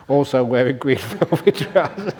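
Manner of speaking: laughing through the words at his own joke. Real, not acted. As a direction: chuckle mid-phrase.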